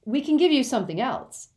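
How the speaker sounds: eager offer, high and loud; Ron's eager bargaining voice. Acted. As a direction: jump in keenly with a counter-offer. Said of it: "said Ron eagerly".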